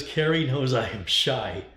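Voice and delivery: drawn-out mock reading of Brian's message; reading Brian's comment. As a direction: announce the chat line deliberately, word by word. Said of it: "Brian says".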